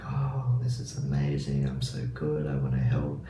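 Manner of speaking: a lightly voiced smug positive mind; sweet positive voice. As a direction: murmur like the mind flattering itself. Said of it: "'cause it's so positive".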